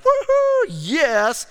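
high, loud mock whoop, then a punched yes; gleeful cheer. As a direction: whoop it up in imitated triumph. Samaritans' imagined gleeful reaction.

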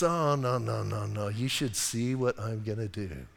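low, soft God voice answering; quietly voicing God's calm reply. God's voice in dialogue.

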